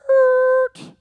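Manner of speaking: loud, high tire-screech imitation; screeching brakes. Storyteller voicing the car stopping as it leaves, screeching brakes. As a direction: imitate a car braking hard with a piercing squeal. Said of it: "all of a sudden they hear".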